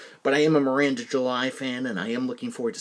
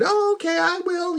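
very high-pitched mock woman's voice; friendly neighbor impression; 4 dB louder than usual. Neighbor accepting the dog walker's promise, high imitated voice. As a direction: imitate her agreeable reply way up high.

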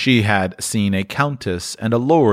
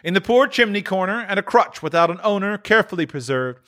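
higher, solemn spirit voice; the ghost; 11 semitones higher than usual. A narrator's reading. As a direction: foretell the empty seat gravely. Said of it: "replied the ghost".

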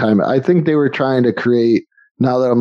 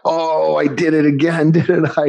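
giddy linebacker quote; 6 semitones higher than usual. Retold or imitated. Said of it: "he's like".

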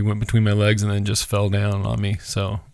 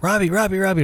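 calling out; 8 semitones higher than usual. Retold or imitated. Wife calling him urgently.

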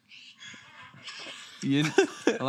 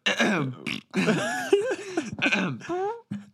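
fake throat clear; 9 semitones higher than usual. Shown as a demonstration. Showing friends how people cover a fart, exaggerated throat clear. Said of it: "Or, like, clearing their throat".

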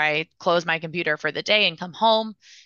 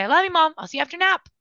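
put-on much higher, cheerful child's goodbye; a small boy telling his mom goodbye; happy little boy; 10 semitones higher, 3 dB louder, much faster than usual.

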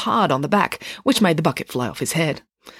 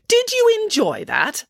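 teasing; slower than usual. Acted. Nanny needles waiting doctor.

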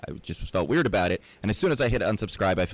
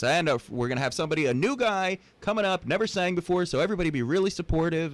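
lightly voiced DJ, pitched up; karaoke DJ; 10 semitones higher than usual. DJ asks the bar to support a new singer, lightly imitated announcer. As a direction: introduce a singer like a DJ, higher voice. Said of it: "the D J gets on and goes".

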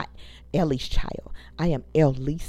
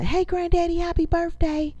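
re-enacted birthday greeting; 9 semitones higher than usual. Greeting granddaddy on his ninetieth over video chat, high imitation.

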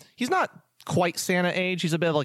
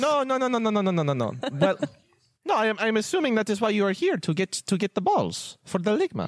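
high, bubbly elf voice; cheery high-pitched elf innkeeper welcome; jolly elf Festo; 7 semitones higher than usual. Acted. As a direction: greet them like the jolliest elf alive.

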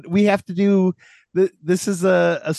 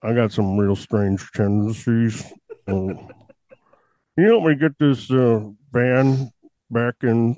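Buffalo Bill; 4 semitones lower than usual. Pretend. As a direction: menace the listener like a horror film villain. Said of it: "I'm Buffalo Bill".

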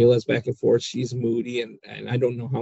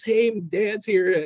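put-on proud teen daughter; 9 semitones higher, much slower than usual.

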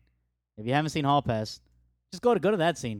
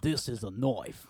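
Crocodile Dundee quote; 2 semitones lower, 4 dB quieter, slower than usual. Retold or imitated. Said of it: "Crocodile Dundee's a national treasure".

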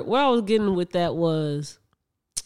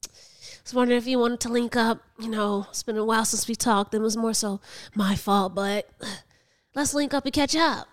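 ex-best friend's voice; 3 semitones higher than usual. Retold or imitated. Woman re-enacts ex-friend's message to reconnect, mimicking voice. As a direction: imitate the ex-friend leaving a message. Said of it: "that's her voice".

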